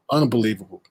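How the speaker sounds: ordinary talk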